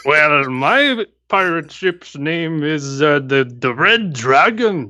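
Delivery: put-on slow, mixed-up Irish-Scottish accent; Irish-Scottish pirate. Pirate captain names his ship, slow Irish-Scottish accent. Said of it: "sounds like an Irish Scottish mix".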